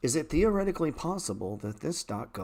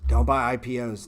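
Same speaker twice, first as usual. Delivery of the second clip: louder, pushed repeat of Don't; mock yell; 7 dB louder than usual. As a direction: bark the warning a second time, louder. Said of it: "I was yelling at everybody".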